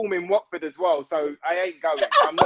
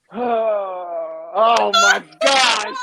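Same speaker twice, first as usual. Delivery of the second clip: embarrassed laughing cry; 2 semitones higher, 6 dB louder, much slower than usual. Real, not acted. Teased about the dream boy joke, laughing embarrassed cry. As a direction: cry it out half-laughing, mortified.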